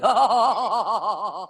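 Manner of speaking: mock hearty laugh; demo laugh. Shown as a demonstration. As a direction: show one kind of laughter. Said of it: "Some people".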